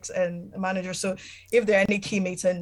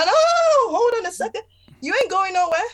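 retold protest, sharply higher and a bit louder; high-pitched protest; 13 semitones higher, 5 dB louder than usual. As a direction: voice jumps way up in indignant disbelief. Retold protest to dying husband.

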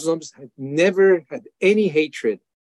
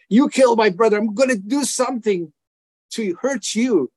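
a flash of remembered fury; retold revenge anger; 7 semitones higher than usual. To his brother's killers, a higher vengeful threat. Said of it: "first I wanted revenge".

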